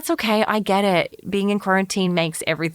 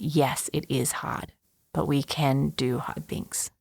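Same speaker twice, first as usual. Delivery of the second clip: soft whisper; 3 semitones lower, 6 dB quieter than usual. Real, not acted. Coach comforting exhausted listeners, in a soft whisper. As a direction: whisper it, low and tender. Tender closing message to listeners.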